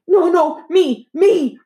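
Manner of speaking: high, loud insistent pleading; child insisting she be picked, high and loud; urging child. A narrator's reading. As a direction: push hard to be chosen. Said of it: "urged Dee Dee".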